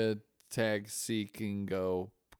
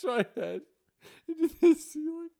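laughing. Real, not acted. Laughing retelling head bump.

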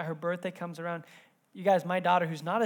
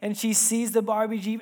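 usual speaking voice

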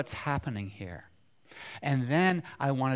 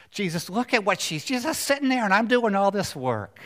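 high, complaining, louder plea; perturbed Martha; 7 semitones higher, 6 dB louder than usual. Acted. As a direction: whine it out like a flustered hostess. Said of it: "and she says".

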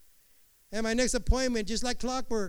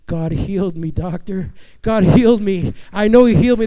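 building cry; 14 dB louder than usual. Retold or imitated. Healed patient telling his doctor, building to a shout. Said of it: "I said".

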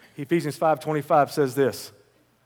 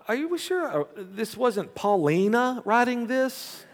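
mock high voice; 9 semitones higher, slower than usual. Joking quote, high voice.

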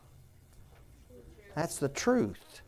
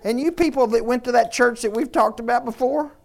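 full-force preaching; 5 semitones higher, 8 dB louder than usual. Acted. Pastor urging the congregation toward the truth, preaching hard. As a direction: drive it home like a revival preacher.

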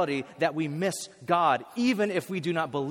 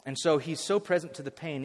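ordinary delivery

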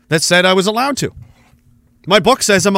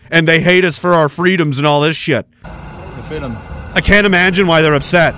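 ordinary talk, nothing marked

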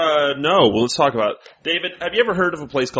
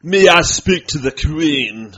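put-on slow, raised posh English accent; lightly put-on English accent, drawn out; mock British; 5 semitones higher, 5 dB louder, much slower than usual. Friends joking about Scotland, mock English accent.

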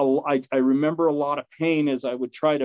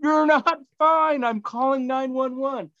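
mock scream; 12 semitones higher than usual. Dog owner yelling at the injured man, high and panicked. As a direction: shout it at him in a panic. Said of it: "I remember him screaming at me".

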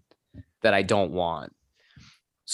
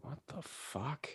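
slow, much softer, higher-pitched disbelief; stunned disbelief; 7 semitones higher, 19 dB quieter, slower than usual. Retold or imitated. Salesman told he owes the draw, quiet aghast disbelief. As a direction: breathe it out slowly, aghast.

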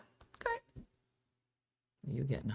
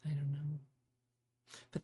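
whisper; 3 semitones lower, 13 dB quieter than usual. Real, not acted. Whispered aside.

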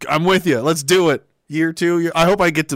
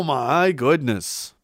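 usual speaking voice

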